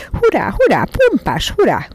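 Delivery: high, swooping hurrahs; excited, piercing whoops; sharp, cheering cry. A narrator's reading. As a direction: cheer shrilly with glee. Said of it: "kiáltotta éles hangján".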